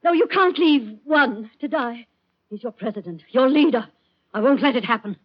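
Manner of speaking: desperate pleading. Acted. Woman pleading to save president.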